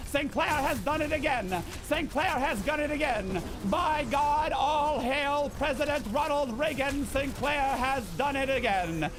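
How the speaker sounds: hammed-up Mid-Atlantic announcer cheering; excited radio call. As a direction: shout it like the old radio man gone wild. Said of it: "the Mid-Atlantic era".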